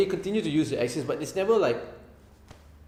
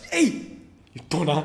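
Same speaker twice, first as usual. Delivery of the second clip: put-on Malaysian accent. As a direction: scold like a Malaysian auntie captain. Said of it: "in the captain's chair she go like".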